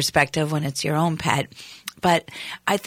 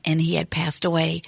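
ordinary talk

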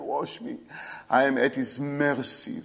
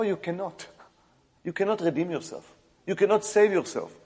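normal talking voice

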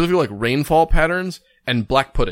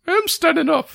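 much higher, small squeaky voice; squeaky reply. Retold or imitated. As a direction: answer like a tiny man. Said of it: "And, like".

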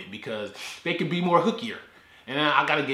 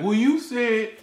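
mock complaining viewer; 10 semitones higher, 5 dB louder, slower than usual. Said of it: "be like".

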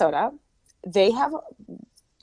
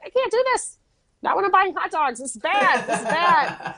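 high-pitched comic protest; mock panic; 9 semitones higher than usual. Shopper tempted while buying hot dogs, mock panic. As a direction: wail it in hammed-up dismay.

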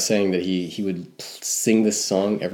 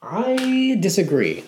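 mock dramatic disagreement; 13 semitones higher, 4 dB louder, slower than usual. Playful mock objection to cohost.

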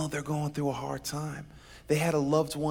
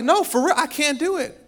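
higher, insistent protest; pleading Joseph; 13 semitones higher, 8 dB louder than usual. Acted. Joseph protesting to Pharaoh, high and insistent. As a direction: beg them to believe you. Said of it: "And Joseph's like".

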